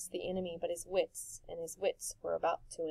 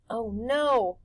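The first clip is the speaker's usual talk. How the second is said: ordinary delivery, nothing marked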